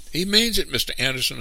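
high, loud, alarmed plea; frightened. A narrator's reading. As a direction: warn the boss in a fright. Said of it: "another frightened lumberman ventured".

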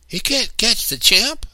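put-on a girl boasting to her brothers; boastful. A narrator's reading. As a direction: brag about being uncatchable. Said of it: "I boasted".

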